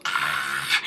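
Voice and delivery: low startled gasp, acting out a faked fright; half-hearted mock fright gasp. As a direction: imitate her fake panic with a sharp gasp. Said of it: "she's, like, freaking out".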